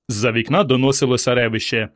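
ordinary delivery, nothing marked

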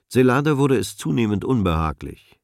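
ordinary delivery, nothing marked

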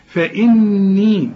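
فإني with a long humming n, slowly; nasal ghunna. Shown as a demonstration. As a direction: hum the doubled n in فإني. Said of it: "كل نون عليها الشد لازم تغن". (غنة طويلة في فإني)

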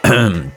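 small throat-clearing to get attention; polite cough. Acted. Peter coughs before addressing Timmins, polite throat-clearing. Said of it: "и кашлянул".

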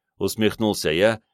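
usual speaking voice

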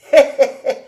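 loud, high chuckle; delighted chuckle. Acted. Old David amused by Cobus's gluttony, chuckling. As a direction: laugh heartily in character.

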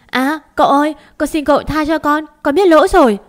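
high, loud frantic begging; terrified shriek. A narrator's reading. Maid begging the master not to cut her tongue, terrified shriek. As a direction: wail for mercy at a high pitch. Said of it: "liền rú lên".

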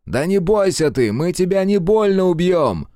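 loud, high, slow taunting call; mocking shout. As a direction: yell a cruel joke at him. Mocking shout at wounded enemy. (насмешливый громкий выкрик)

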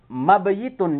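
mabayitun with the yi sounded separately; incorrect uncontracted reading. Shown as a demonstration. Teacher rejecting this form too, mabayitun with yi. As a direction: keep the y glide in mabayitun. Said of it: "juga bukan gitu".

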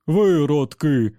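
slow, loud, inhuman robot roar; furious machine voice, dragged out; raging robot. Acted. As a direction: bellow it like a broken machine. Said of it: "Цей голос був не дуже схожий на звиклого Енді".

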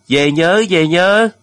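loud, high call across the water; farewell shout. A narrator's reading. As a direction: holler goodbye to a departing ship. Crowd on shore shouting farewell. (gọi to tạm biệt, giọng vút cao)

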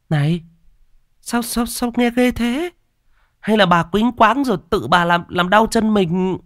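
high woman's voice, uneasy and questioning; doubting woman onlooker. Acted. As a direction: question her story, stammering a little at first.

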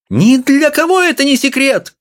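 very high, piercing shout; shrill cry. A narrator's reading. Wife shouting back at the professor, shrill. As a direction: cry it out in indignation. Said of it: "крикнула она".

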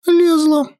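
put-on high, lively girl voice; sulky teenage granddaughter; young girl voice. A narrator's reading. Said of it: "призналась его внучка".

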